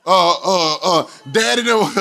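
normal talking voice

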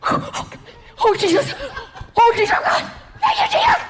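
soaring high, breathless thank-you cries; ecstatic praise. Retold or imitated. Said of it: "going like this".

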